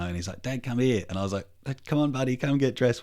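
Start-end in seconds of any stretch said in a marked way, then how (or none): 0.4-1.0 lightly voiced small boy, higher; child calling dad. Young son calls dad to the bedroom, lightly imitated child. 1.8-3.0 higher, lightly acted coaxing; coaxing dad. Dad wants son dressed for the farm, mildly coaxing.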